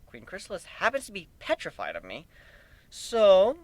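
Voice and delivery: ordinary delivery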